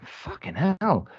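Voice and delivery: slow, high, drawn-out groan of dismay; retold dismay. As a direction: stretch it out slowly, mock despair.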